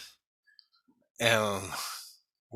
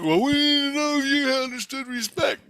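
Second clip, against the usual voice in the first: high-pitched mock explaining voice; high, insistent imitation; imitated explanation; 13 semitones higher, 4 dB louder than usual. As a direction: mimic his reply way up high. Retold reply of Jesus.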